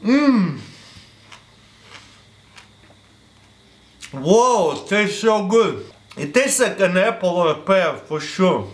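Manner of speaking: chewing while talking; muffled by food; mouth full of fruit. Real, not acted. Seen 1: Bites fruit at -2; chewing lips visible early, later speech clearer.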